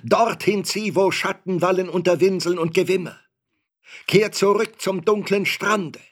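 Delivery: high, loud raging banishment; furious, desperate commands at full voice; frenzied shouting. A narrator's reading. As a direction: drive the creature away, nearly out of your mind. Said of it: "schrie ich auf, beinah von Sinnen".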